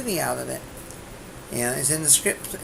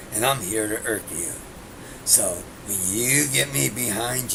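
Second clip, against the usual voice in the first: Officer Erks voice; 8 semitones lower, 4 dB quieter than usual. Acted. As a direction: lean into a deep smug cop threat. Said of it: "I came up with Officer Erks".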